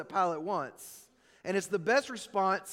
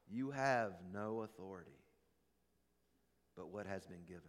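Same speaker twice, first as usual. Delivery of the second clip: solemn hush; 11 semitones lower, 11 dB quieter than usual. A narrator's reading. Preacher echoing Jesus to the congregation, soft and solemn. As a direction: drop to a hush to land the truth.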